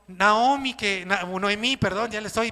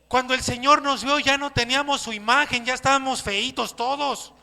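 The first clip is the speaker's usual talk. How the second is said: normal talking voice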